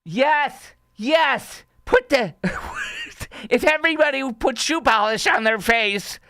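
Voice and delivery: high-pitched mock enthusiasm, cracking up midway; mocking mock-agreement. Host ridiculing the clip's idea, high mocking glee. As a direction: crow it in high, hammed-up agreement.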